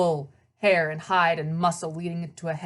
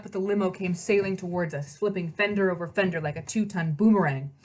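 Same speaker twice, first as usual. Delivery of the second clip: usual speaking voice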